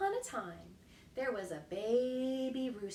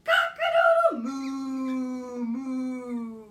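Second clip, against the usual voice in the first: loud, slow crow; top of his voice; 11 dB louder, much slower than usual. A narrator's reading. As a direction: belt it out proudly. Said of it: "at the top of his voice".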